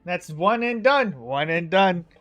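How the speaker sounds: loud, high crowing that eases down in pitch; gleeful gloating. Acted. Topsy and Turvy gloating over the failed king, loud and high. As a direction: rub it in at full volume. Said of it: "And Topsy and Turvy go".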